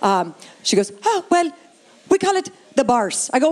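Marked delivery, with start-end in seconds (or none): 1.0-3.3 put-on Irish accent. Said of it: "a woman from Ireland".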